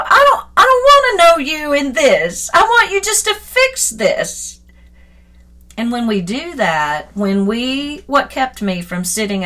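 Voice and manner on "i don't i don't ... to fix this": pleading with God. Retold or imitated. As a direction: beg God to just fix it. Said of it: "like I was saying to God".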